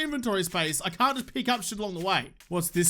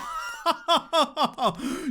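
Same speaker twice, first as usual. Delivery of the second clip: gloating laugh; 6 semitones higher than usual. Real, not acted. Gamer gloats over headshot crow, triumphant laugh. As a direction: cackle in triumph over the kill.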